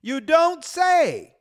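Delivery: slow, loud, high mock surprise; dripping sarcasm, drawn out loud; sarcastic. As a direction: drag it out like it's obvious. Sarcastic reply to own point.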